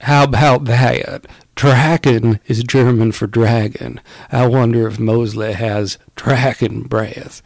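slow, drawn-out actor impression; Tim Curry. Host signing off as a British actor playing a part, slow impression. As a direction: stretch each phrase in a hammed-up impression. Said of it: "Great Tim Curry".

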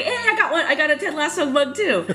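high, laughing mock brag; playful boast. Member of theater company has a mug, playful boast.

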